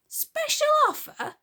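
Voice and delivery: very high, loud squeak of disbelief; hammed-up shocked father; horrified echo. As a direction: echo it aghast.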